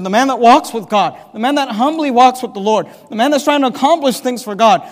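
loud, fervent sermon build; preaching at full force. Acted. Preacher lifting his voice to the church, loud and fervent. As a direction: swell toward the last line.

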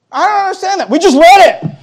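high, loud disbelief; mock incredulity. Real, not acted. Pastor incredulous at the congregation, high and loud. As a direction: sound amazed anyone missed it.

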